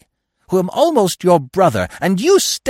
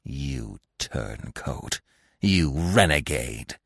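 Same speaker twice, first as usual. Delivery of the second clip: slow, soft, contemptuous growl; low, bitter accusation; embittered growl; 5 semitones lower, 5 dB quieter, much slower than usual. A narrator's reading. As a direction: draw out each insult with restrained hatred. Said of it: "he growled".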